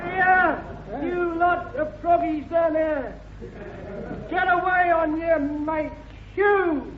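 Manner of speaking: broad Cockney, slow and cheeky; a market-trader Cockney, drawn out; Cockney accent. Acted.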